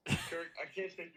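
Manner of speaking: loud, playful protest; loud joking refusal. Real, not acted. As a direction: push the joke out loudly.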